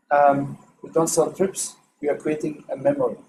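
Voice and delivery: usual speaking voice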